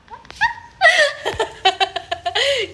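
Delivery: long, high-pitched laughing fit; high laughter. Real, not acted. Sisters joking about homework excuse, high falling laughter. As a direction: burst out laughing and let it fall away.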